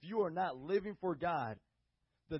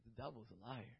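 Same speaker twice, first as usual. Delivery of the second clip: quiet firm statement; 8 semitones lower, 16 dB quieter than usual. Retold or imitated. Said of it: "I'm like".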